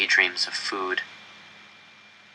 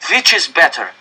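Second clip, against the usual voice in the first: raised, higher demanding address; forceful official; 9 semitones higher, 5 dB louder than usual. Acted. Director arguing with the enraged crowd, raised and forceful. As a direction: force them to face the choice.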